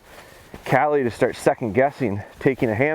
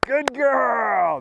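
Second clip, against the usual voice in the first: praise; 11 semitones higher than usual. Real, not acted. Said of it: "you see how my voice and my excitement".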